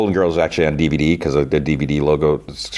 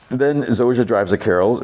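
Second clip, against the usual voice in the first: ordinary delivery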